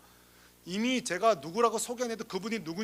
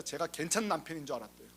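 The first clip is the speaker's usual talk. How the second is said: ordinary talk, nothing marked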